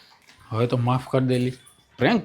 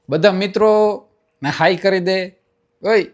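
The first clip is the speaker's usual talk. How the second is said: raised, loud cajoling call; cheery coaxing; 7 semitones higher, 5 dB louder, slower than usual. Real, not acted. Prankster urging crying girl to greet viewers, cheery. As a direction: coax her brightly towards the camera.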